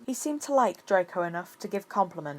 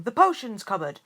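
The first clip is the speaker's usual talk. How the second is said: blurted; 4 semitones higher, 7 dB louder than usual. A narrator's reading. Almost blurted answer.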